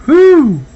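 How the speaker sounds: high loud whew from heat. Real, not acted. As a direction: blow out at the hot bite. Burning hot soup reaction.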